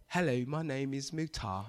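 put-on British accent, low and soft. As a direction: introduce yourself in a mock British accent. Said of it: "if I give a British accent".

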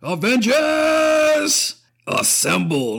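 high, very slow drawn-out battle cry; imitating Captain America's rally call. Fan quoting Cap calling the Avengers, stretched high. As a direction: stretch it out like a hero rallying troops. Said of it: "every time I hear Rogers".

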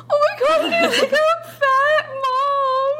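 high, slow hammed-up child's wail; high mock crying cry for Mom. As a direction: wail it like a little kid told she's fat. Child wailing in retold story.

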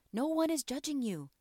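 usual speaking voice

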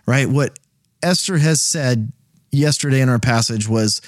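ordinary delivery

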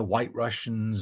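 usual speaking voice

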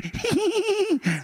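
high, squealing put-on laugh; demonstrated giggle. Shown as a demonstration. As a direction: imitate a silly high-pitched giggle. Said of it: "me río de que".

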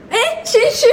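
high, loud laughing burst; laughing exclaim. Real, not acted. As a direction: crack up while calling it humble. Host laughs at husband's retort. (大笑着高声说谦虚)

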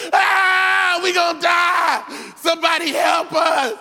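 high, loud panicked shouting; terrified disciples yelling for help; panicked screaming. Acted. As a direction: scream like you're about to drown. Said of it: "they start screaming like girls".

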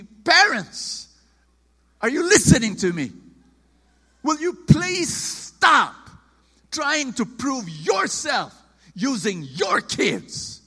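preacher shouting a plea to parents; impassioned shout. Real, not acted. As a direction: call out to the parents, slow and loud. Pleading with parents from pulpit.

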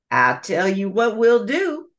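a crafty gator's deal; scheming alligator. Acted. Alligator proposes compromise.

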